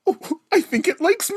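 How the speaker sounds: a hint of a smitten schoolkid, rising high; lightly voiced giddy middle schooler; middle school reading. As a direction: read it like a seventh grader with a crush. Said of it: "a more middle school reading of".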